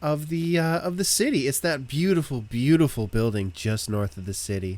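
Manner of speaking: high, eager local giving directions; lilting, over-pleasant villager; cheerful townsperson. Acted. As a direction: gush helpfully about the town.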